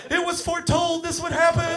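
put-on wailing kid prophet. Fallen kid declaring his prophecy, high mock wailing. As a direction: wail it like a tiny prophet of doom.

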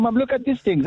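put-on high excited child voice calling mom; a boy calling his mom to look, excited. Boy showing mom a scorpion, high and excited. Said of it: "I was telling my Mom".

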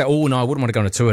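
fast mock dismissal. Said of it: "Some people say".

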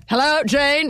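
high, projected call; hammed-up theatrical greeting; mock stage yell. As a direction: call out to the back row. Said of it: "starting to yell".

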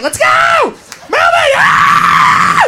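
high shouted orders into a scream; acted drill-sergeant friend; bossy yelling. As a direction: herd your friends out the door, yelling. Organizer yells to get moving.

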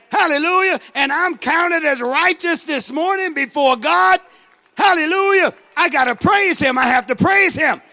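loud, fervent praise; preaching at full force. Real, not acted. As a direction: shout your praise to God.

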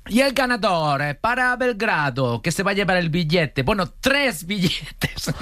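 high, drawn-out presenter announcement. Retold or imitated. TV hostess announcing the Belgrade winner, high and stretched-out.

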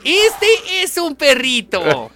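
high slow squeaky clown. Acted. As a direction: present the balloon dog slowly. Clown balloon animal demo.

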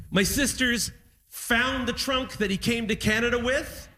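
usual speaking voice

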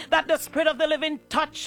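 normal talking voice

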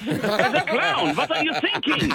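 a hint of a scolding father; mock stern parents. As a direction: play the outraged German parents while cracking up. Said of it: "And they're going".

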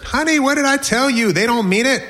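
high, singsong reassuring mother; insistent mom. Acted. Mom to son kicking dirt, insistent.

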